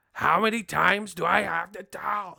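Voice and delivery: hammed-up exasperated old man; mock angry Arum. Bob Arum fed up with an interviewer, mock angry. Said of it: "are you angry already".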